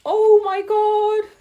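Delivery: very high, loud, drawn-out exclamation; mock-horrified squeal. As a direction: squeal it slowly at the oil, hammed-up. Mock horror at oily cardboard.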